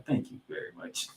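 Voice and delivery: whispered thanks. Real, not acted. Gloating over the win.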